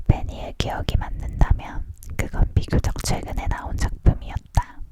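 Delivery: ordinary delivery, nothing marked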